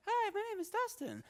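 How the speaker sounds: squeaky, sky-high mock introduction; exaggerated falsetto impression of a kid; absurdly high voice. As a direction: mimic someone imitating you way up the scale. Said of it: "really high on the, on the scale there with his voice".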